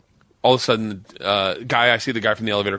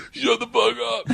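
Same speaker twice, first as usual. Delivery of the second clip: amazed disbelief; 12 semitones higher than usual. Retold or imitated. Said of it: "he'll be like".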